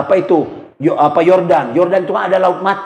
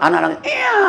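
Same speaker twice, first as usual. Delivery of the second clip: dismissive mother. Acted. Mother brushes off crying.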